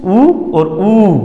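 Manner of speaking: ऊ held long, then ऊ stretched even longer; drawn-out vowel lengths. Shown as a demonstration. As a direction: stretch each ऊ to show its length. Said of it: "उकाल का मतलब उ". (स्वर को खींचकर लंबा बोलना)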